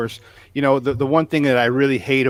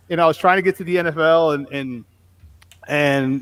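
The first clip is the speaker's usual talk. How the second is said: ordinary delivery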